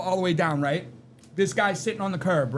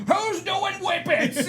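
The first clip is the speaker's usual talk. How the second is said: mock manager; 12 semitones higher, much slower than usual. Manager high mock question.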